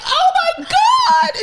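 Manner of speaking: high, drawn-out mock wail; mock crying. Colleague bursting into tears at work, mock crying. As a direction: wail it like an overdone sob. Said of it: "crying even more".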